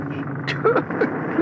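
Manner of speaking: a squeaky high giggle; a disbelieving titter; high breathy laugh. Acted. Character laughs in disbelief at the marmalade sandwich, high giggle.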